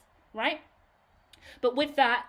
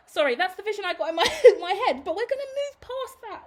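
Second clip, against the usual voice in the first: laughing through the words, higher and louder; cracking up at her own thought; real giggly embarrassed apology; 9 semitones higher, 7 dB louder, faster than usual. Real, not acted.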